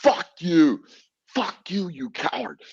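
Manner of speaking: high-pitched defiant curse, fading off; retold angry taunt. Taunting the officer who clubbed him, high retold curse. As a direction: spit the insult at the man who hit him. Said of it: "I was like".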